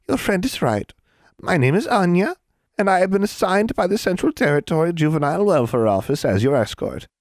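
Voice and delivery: high-pitched hag's introduction; cheerful, officious hag. A narrator's reading. Hag introduces herself as the children's escort, cheerful and officious. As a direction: announce yourself with official cheer.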